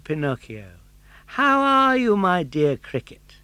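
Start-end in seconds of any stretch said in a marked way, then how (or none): 1.4-3.4 high, louder sweet greeting; a puppet boy being overly friendly; Pinocchio's voice. Acted.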